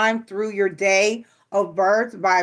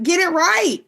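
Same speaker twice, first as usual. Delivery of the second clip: higher, louder scold at midwives; 9 semitones higher, 4 dB louder than usual. Real, not acted. Scolding midwives about birth times, higher and louder. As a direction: snap it like a warning.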